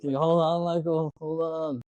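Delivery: imitated calling out. Retold call between hanging friends.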